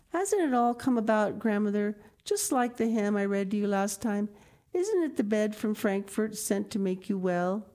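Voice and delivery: joyful, clinging to her grandmother; happy child. Acted. Heidi joyfully asking grandmother, eager child voice.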